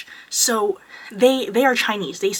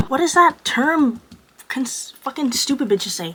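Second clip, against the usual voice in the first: ordinary talk, nothing marked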